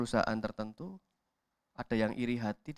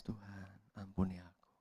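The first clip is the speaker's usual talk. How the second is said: tearful prayer; 4 semitones lower, 10 dB quieter than usual. Acted. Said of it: "Baru kita nangis".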